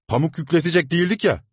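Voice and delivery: loud, high, fast snapping retort; angry retort. A narrator's reading. Servant snapping back at the boy. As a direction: throw it back at him.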